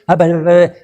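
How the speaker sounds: high, playful nonsense syllables; mock silly babble. Priest imitates a demon acting dumb, mock babble. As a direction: make the demon sound stupid on purpose. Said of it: "imitan como que son estúpidos".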